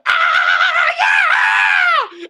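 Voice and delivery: high, loud rock-star wail; rock star scream. Retold or imitated. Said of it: "he's running out of the woods with his fucking big hair going".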